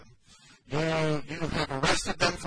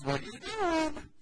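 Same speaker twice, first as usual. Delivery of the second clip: put-on teasing cutesy voice for a toddler; playful baby-talk; 12 semitones higher than usual. Shown as a demonstration. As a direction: coo it at a little child, rising higher. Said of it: "You know this way our voice does".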